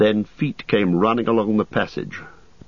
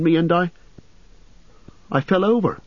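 frightened; 10 semitones higher than usual. Acted. Merry recounts terror.

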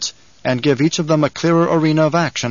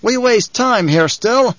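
high, slow impatient call; raised, high-pitched objection; calling from above; 9 semitones higher, 3 dB louder, slower than usual. A narrator's reading. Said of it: "The voice from the rocks above them called".

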